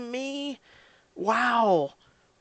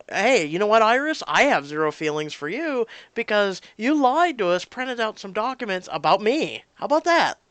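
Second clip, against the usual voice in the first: louder, higher, scolding a character; mock confrontation; 4 semitones higher, 6 dB louder than usual. Player scolding Iris for lying, indignant and loud. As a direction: tell her off to her face.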